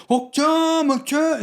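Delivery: high, hammed-up Korean exclamation; big overacting. Mimicking how they talk in Squid Game, exaggerated high cry. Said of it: "so it's big".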